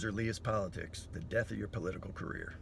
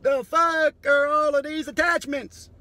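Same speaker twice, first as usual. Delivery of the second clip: much higher, louder hammed-up shouting; future species shout; 12 dB louder than usual. Future species finding human remains, high excited shouts. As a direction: call the whole crew over, yelling high. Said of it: "They'll be like".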